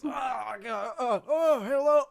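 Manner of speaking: startled panic. Acted. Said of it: "and he goes".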